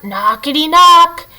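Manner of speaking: usual speaking voice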